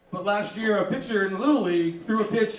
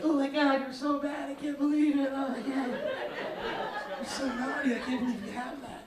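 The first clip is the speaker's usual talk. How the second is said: higher, softer giggly scandalized gushing; girly mock shock; 5 semitones higher, 6 dB quieter than usual. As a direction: gush like a scandalized girlfriend. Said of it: "you're like".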